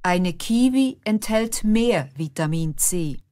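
usual speaking voice

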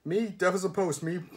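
anxious, babbling cockney; nervous burble. A narrator's reading. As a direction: burble eagerly to save your skin. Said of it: "burbled Ernie".

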